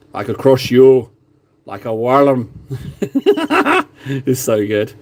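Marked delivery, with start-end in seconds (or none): 0.1-2.5 gruff Scottish boast, lightly done; mock Scottish giant threatening; Scottish accent. Retelling Braveheart, a lightly done Scottish brag. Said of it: "the bit in Braveheart where it's like".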